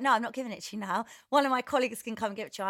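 rapid brush-off. Retold or imitated. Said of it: "He went".

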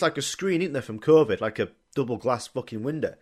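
ordinary talk, nothing marked

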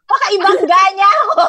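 loud, high, laughing through the words. Real, not acted. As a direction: giggle it out in disbelief. Laughing about scary driver. (tumatawa habang nagsasalita)

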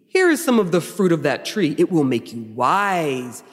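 theatrical tempter, dwelling on key words; hammy, measured devil; Michael Baum Lucifer. Acted. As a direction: sell the temptation with stretched, stagey emphasis. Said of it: "my Michael Baum Lucifer".

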